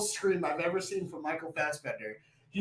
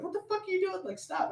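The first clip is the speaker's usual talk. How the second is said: mock alarm; 13 semitones higher, 4 dB quieter, faster than usual. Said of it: "then he's like".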